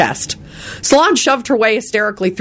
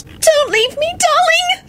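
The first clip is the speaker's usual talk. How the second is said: very high wailing plea; slower than usual. A narrator's reading. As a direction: wail to her dying husband. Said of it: "Solange wailed".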